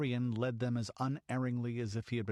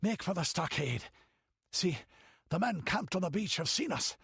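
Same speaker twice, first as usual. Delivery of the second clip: panting; 7 semitones higher than usual. Acted. Panted Zerono.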